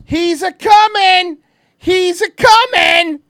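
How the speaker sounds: loud, high calls stretched out at the end; hyped-up warning. Real, not acted. Streamer warning viewers the man is coming, excited.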